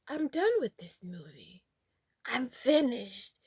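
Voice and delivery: whispered, slow, defeated; whispered kid quitting the movie; whispered give-up. Retold or imitated. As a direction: whisper it like you've given up. Fed-up kid giving up.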